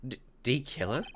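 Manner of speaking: stammered, disbelieving question; surprised. Acted. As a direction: stumble on it in shock. Stunned echo of the name.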